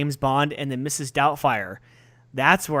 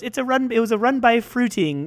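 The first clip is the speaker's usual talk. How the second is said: put-on English nanny accent; 8 semitones higher, 4 dB louder than usual. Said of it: "And he goes".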